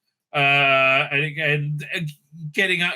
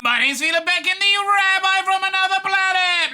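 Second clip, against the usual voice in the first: high, grand announcer intro; announcer sign-off; 4 dB louder than usual. Acted. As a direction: boom it like a showman. Channel sign-off.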